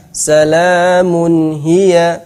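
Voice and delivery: Salamun hiya slow and flowing; smooth recitation. Shown as a demonstration. Teacher showing correct smooth reading. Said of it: "Tetap bacanya lentur".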